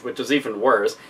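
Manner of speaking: ordinary delivery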